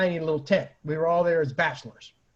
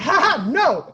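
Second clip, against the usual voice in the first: mock laugh; 8 semitones higher, 5 dB louder, slower than usual. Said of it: "I'm like".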